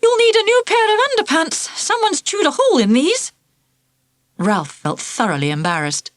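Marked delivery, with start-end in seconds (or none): 0.0-3.3 high, amused teasing voice; amused nurse. Acted. Nurse to Ralph, teasing him about the bitten underpants. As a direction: tease him cheerfully. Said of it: "she laughed out loud and said".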